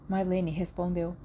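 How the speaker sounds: ordinary delivery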